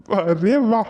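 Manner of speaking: ordinary delivery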